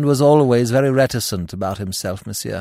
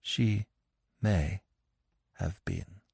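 halting, very soft, word by word; broken widow's admission, barely voiced; brokenly; 11 dB quieter, slower than usual. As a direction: let it break apart between words. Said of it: "said brokenly".

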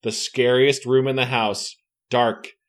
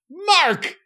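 shouting a name, high and loud; calling out; 4 dB louder than usual. Acted. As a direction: yell for Mark in alarm. Character calls out in fear.

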